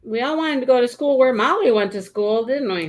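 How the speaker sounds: ordinary talk, nothing marked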